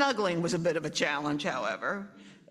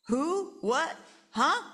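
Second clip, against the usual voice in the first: high startled confusion; 8 semitones higher, 3 dB louder, slower than usual. Acted. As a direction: jolt awake, confused. Parents startled.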